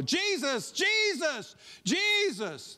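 high pleading call, repeated; calling out for Jesus. Retold or imitated. People flagging Jesus down in the crowd, calling out high. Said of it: "they try to flag him down".

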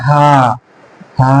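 light h on হা, without throat friction; small ha. Shown as a demonstration. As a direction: say হা as a plain, easy Arabic small h. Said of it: "যেটা এরকম, এই হা".

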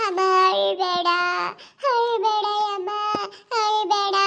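put-on high, drawn-out child voice; pleading child. Child begging mother not to cry, high and pleading.

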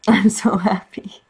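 laughing with joy. Real, not acted. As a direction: giggly whisper of delight. Delighted after the scene.

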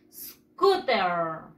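SCOOTER with the last syllable drawn out and dropping low; little tune at the end. Shown as a demonstration. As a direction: model SCOOTER for repeating, pitch sliding down on "-ter". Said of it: "Ese tonito al final".

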